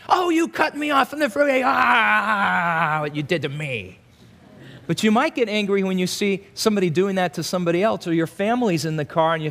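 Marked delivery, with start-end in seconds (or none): 0.1-4.0 mock road rage. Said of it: "Not at the person that".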